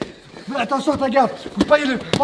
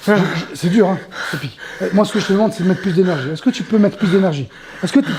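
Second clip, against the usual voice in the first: ordinary talk, nothing marked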